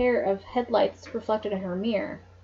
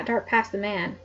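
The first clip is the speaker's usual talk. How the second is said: ordinary delivery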